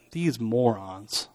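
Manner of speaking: write them off under your breath; acted weary disdain for the crowd; dismissive scorn. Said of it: "they're dismissing the crowd".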